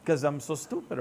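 usual speaking voice

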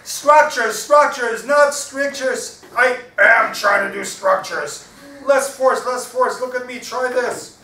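loud, high, emphatic parrot; insistent parrot. Acted. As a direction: correct them loudly in a high voice. Parrot acting out signs, insisting.

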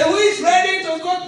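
high, loud far-carrying call; mock trader calling out. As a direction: shout it to the crowd at the beach.

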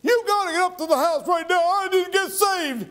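high, urgent woman's plea, retold; urgent excitement. Mert calling the preacher to come at once, high urgent voice. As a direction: rush it like she can't wait.

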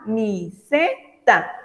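camiseta drawn out syllable by syllable; slow syllable demo. Shown as a demonstration. Teacher repeating the example word slowly for kids. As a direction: say camiseta slowly so children hear each part. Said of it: "El segundo tipo de sílabas".

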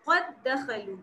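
"कद दखलू" with the dal joined, heard only once; merged recitation. Shown as a demonstration. Teacher shows students the merged idgham reading. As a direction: run "कद" straight into "दखलू" as one word. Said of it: "ऐसे पढ़ेंगे कि जैसे यहाँ पे एक single दाल है".

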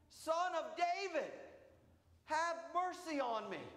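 high, slow desperate cry for mercy. Acted. Blind beggar crying out to Jesus over the crowd, high desperate plea. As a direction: call out across the crowd, pleading. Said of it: "screams at the top of his lungs".

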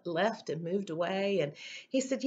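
ordinary talk, nothing marked

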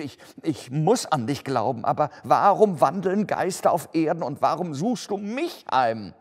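loud, high, distraught questioning; agitated cry. A narrator's reading. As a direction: cry out in fright at the ghost. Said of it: "rief Scrooge". (lauter, aufgewühlter, hoher Ausruf)

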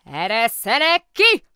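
high, very loud, drawn-out yell; furious bellow. A narrator's reading. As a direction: roar to be let out. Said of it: "üvöltötte egy hang".

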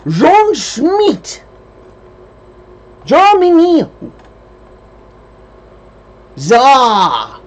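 high, very loud mock German; hammed-up German accent, loud and high; crappy German accent. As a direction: shout drawn-out fake German in a high voice. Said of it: "German accent".

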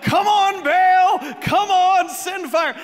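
high, loud frantic pleading shout; yelling. Acted. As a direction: holler to a god like a worked-up crowd. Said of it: "They begin to yell".

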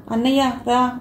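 ordinary delivery

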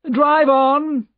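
loud, high command to a driver; imperious nobleman's shout; calling out. A narrator's reading. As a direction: call it out high over the street. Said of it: "he called to his driver".

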